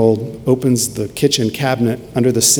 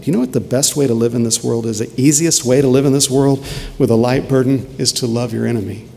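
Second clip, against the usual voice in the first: ordinary talk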